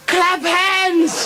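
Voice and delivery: very high, loud cry; woman in ecstasy. Acted. Woman giving her lover an order, high loud squeal. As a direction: squeal the command. Woman's climactic demand in joke.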